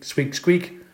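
ordinary delivery

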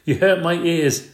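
put-on whiny child. A narrator's reading.

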